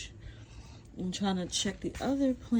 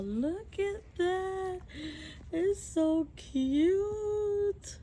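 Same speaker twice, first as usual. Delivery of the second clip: much higher and very slow, doting; cooing; 11 semitones higher, much slower than usual. Real, not acted. First squash, cooing over it.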